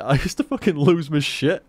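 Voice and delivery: ordinary talk, nothing marked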